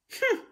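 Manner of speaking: high humph. A narrator's reading. Mama Bear annoyed.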